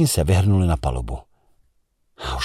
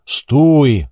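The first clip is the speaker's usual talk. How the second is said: higher, louder shouted stop; 7 semitones higher, 7 dB louder than usual. A narrator's reading. The commander shouting to the engine room. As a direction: bark the halt into the engine pipe. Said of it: "vykřikl jsem do stroje".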